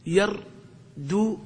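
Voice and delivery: يردد stretched very slowly, syllable by syllable; drawn-out example word. Shown as a demonstration. Teacher showing students the original form يردد, very slowly. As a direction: pull يردد out slowly so each دال is heard. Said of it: "أصلها".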